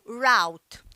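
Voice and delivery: route said like rowt; route rhyming with out, American style; American English route; American pronunciation. Shown as a demonstration. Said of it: "No inglês americano".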